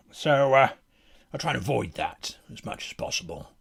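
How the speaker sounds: lightly voiced battle-worn warrior musing on a career; deadpan in-character monologue; world-weary fighter. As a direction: reflect like a seasoned fighter after battle.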